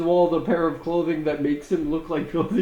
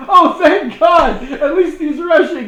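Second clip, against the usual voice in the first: relieved mom; 7 semitones higher, 6 dB louder, faster than usual. Retold or imitated. Relieved mom greets the clown.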